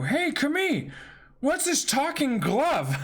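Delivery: high curious voice. Acted. Character asks Camille about the glove, high curiosity. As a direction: ask brightly about the glove.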